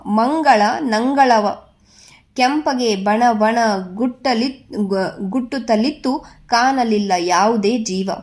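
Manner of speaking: put-on measured, lilting poem reading for children; poem recitation. Teacher reads the Putti poem to grade six children, lilting recitation. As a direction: recite each verse line in rhythmic phrases.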